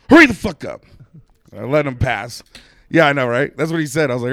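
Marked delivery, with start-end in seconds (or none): 0.1-0.8 loud, high snap of hurry the fuck up; angry retold yell. Drunk neighbor yelling at a car thief, angry. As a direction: bark it at the stranger.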